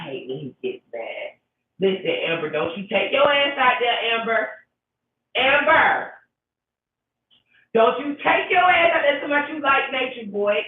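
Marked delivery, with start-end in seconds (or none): 2.5-10.6 rising to a high, loud plea; urgent pleading warning. Acted. To Amber, begging her not to join Nature Boy, rising loud plea. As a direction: beg her not to go, voice climbing higher and louder.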